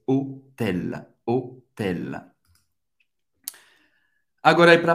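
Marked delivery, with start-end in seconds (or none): 0.1-2.3 "Hotel" as low, separated o-TEL, silent H; French pronunciation. Shown as a demonstration. Teacher modelling the word in French, French pronunciation. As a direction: give "Hotel" a clear French final L. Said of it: "Hotel em francês".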